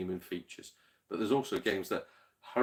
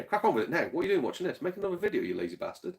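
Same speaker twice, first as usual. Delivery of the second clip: higher-pitched playful telling-off; mock scolding; 8 semitones higher, faster than usual. Viewer ribbing a video maker for slacking, mock scolding. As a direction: jokingly order them back to work, pitched up.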